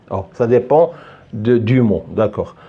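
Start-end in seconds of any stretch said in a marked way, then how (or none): none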